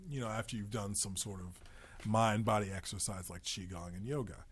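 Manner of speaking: ordinary delivery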